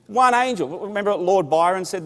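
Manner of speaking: high, loud punch on the punchline; triumphant preaching. Acted. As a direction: announce it like a victory. Preaching at full force.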